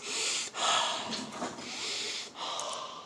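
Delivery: long, slow breath of fire; deep breathing. Shown as a demonstration. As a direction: keep breathing deeply and audibly. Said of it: "I kept breathing".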